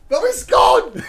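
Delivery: high squeal breaking into laughter; amused dismay. Real, not acted. Filmer losing the recording, laughing exclamation.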